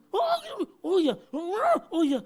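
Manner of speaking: high, loud delighted "oh iya"; eager wife. Retold or imitated. Pastor voices his wife's delighted agreement, high and loud. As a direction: gush like a wife who finally understands.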